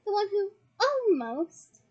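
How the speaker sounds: "almost" drawn out long, high and loud; heavy emphasis on almost. A narrator's reading. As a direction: lean hard on "almost", stretching it. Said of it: "great deal of emphasis on the word".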